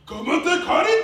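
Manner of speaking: high, very loud war chant; haka shout. Retold or imitated. Man squaring up to his son, haka shout. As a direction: roar out the haka challenge. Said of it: "about to do the haka".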